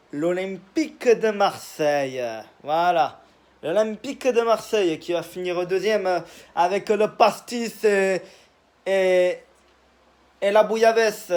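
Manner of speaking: mock the Marseille accent of the OM fans, pastis and all; bad Marseille accent. Teasing Marseille fans in a badly faked Marseille accent. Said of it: "je le fais très mal, l'accent marseillais".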